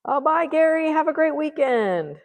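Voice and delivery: high, loud sing-out goodbye; calling out. Real, not acted. Painter saying goodbye to someone leaving, calling out.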